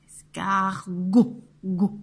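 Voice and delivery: "Escargot" slowly split, then "go" alone; syllables separated. Shown as a demonstration. Teacher isolating the last syllable, "Escargot, go" slowly split. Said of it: "c'est go".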